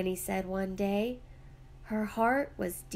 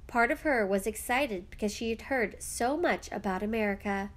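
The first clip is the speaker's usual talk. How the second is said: plain reading voice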